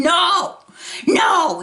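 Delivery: high indignant denial; imitating mom arguing. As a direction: refuse it loudly like the mother. Mother arguing back.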